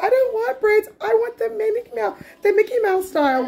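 high-pitched mimicry of a little girl's protest; mock little-kid complaint, high voice; imitated protesting child. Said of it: "She's like".

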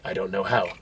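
hushed, timid android reply; quoting Walter. Retold or imitated. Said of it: "He's like".

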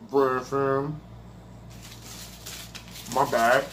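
talking through a mouthful; mouth full. Real, not acted. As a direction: say it while chewing food. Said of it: "I'm talking with my mouth full".

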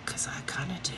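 soft, near-whispered admission; very quiet, almost voiceless; hushed confession. Real, not acted. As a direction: confess it under your breath. Guilty confession after asking if wrong.

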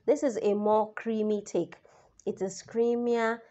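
ordinary delivery

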